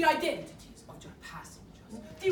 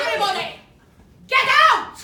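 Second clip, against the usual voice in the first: furious shout; 8 semitones higher, 13 dB louder, faster than usual. Acted. Captor roaring at everyone to get out, furious shout. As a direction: bellow it at full force, very high.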